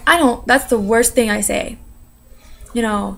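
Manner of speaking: ordinary talk, nothing marked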